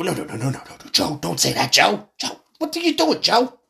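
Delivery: high frantic mock aide pleading with Joe; hammed-up panicking staffer, rising; mock aide begging Joe to stop; panicked aide.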